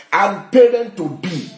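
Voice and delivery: ordinary talk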